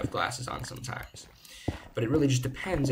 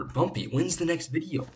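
higher-pitched imitation of an eager fan; eager viewer; 7 semitones higher than usual. Viewers asking the streamer on the server, eager imitation. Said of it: "just people telling me".

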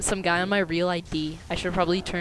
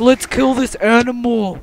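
mock battle cry; 6 semitones higher, 9 dB louder than usual. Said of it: "It's like".

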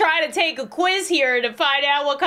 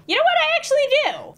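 sudden high, louder burst of realisation; excited realisation; 4 dB louder, much faster than usual. Real, not acted. Answering a personality quiz, suddenly excited and loud. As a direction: leap up in pitch as it dawns on you.

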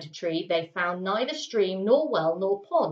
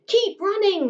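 much higher, louder urgent shout; 12 semitones higher, 5 dB louder than usual. A narrator's reading. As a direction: yell at your friend to keep going. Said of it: "shouted Eric".

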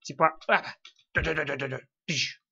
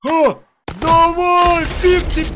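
excited shout; 10 semitones higher, 8 dB louder, much slower than usual. Real, not acted. Urging in game.

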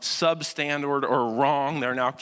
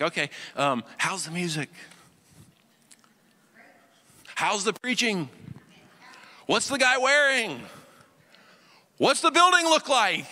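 picky churchgoer; 7 semitones higher than usual. Retold or imitated. Churchgoer critiquing the service.

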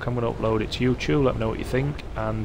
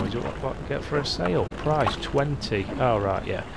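ordinary talk

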